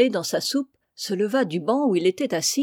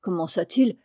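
plain reading voice

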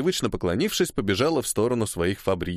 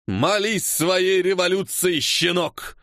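high, slow raised threat; menacing; 6 semitones higher, slower than usual. A narrator's reading. Cossack officer standing over a striker, menacing. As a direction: drag out the threat with contempt.

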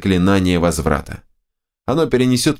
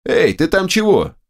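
calling down; 11 semitones higher than usual. A narrator's reading. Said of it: "крикнул я вниз".

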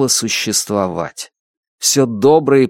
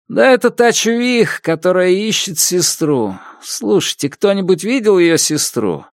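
raised, pleading street addict voice; high junkie voice; 6 semitones higher than usual. Acted. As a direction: beg for coins in a high voice. Junkie begging for coins.

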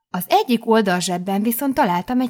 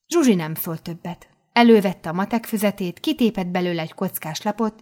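ordinary delivery

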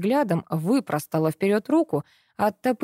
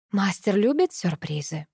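rustling whisper. A narrator's reading. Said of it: "прошелестел ветер у моего уха".

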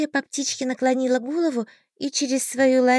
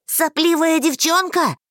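loud, high angry yell; 8 semitones higher, 5 dB louder than usual. A narrator's reading. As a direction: snap it at the girl. Marsten yells.

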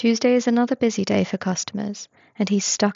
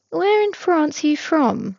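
nosy coworker voice; 8 semitones higher than usual. A narrator's reading. Tobias voiced, nosy questions.

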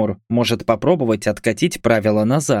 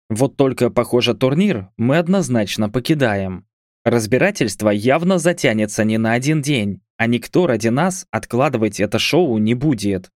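ordinary delivery

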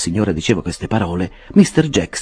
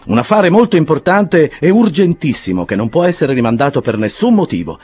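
Jackson the clerk; 10 semitones higher, 4 dB louder than usual. A narrator's reading. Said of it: "disse Jackson".

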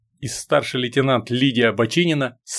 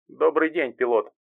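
soft computer voice; 4 dB quieter than usual. A narrator's reading. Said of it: "мягкий голос бортового компьютера".

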